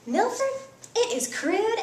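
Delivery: high rising call of the name; mother calling. Acted. Mother scolds son for talking with food, calling his name. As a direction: call him to order, rising.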